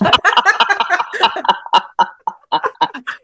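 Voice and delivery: bright rising laugh; amused laugh. Real, not acted. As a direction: burst out laughing. Laughing while wrangling a cat.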